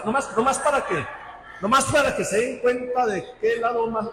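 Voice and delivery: chuckling while teasing; laughing. Real, not acted. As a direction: laugh through the challenge. (riendo mientras reta)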